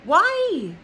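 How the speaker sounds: rising into a loud, high yelled "Why?"; exasperated outburst. Retold or imitated. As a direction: build from disbelief to a loud, high yell. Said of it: "I was like".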